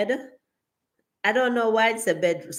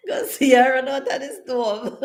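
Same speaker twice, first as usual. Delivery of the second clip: can barely get the words out laughing; giggling out the line; laughing hard; 7 semitones higher than usual. Real, not acted. Cracking up at the chat joke.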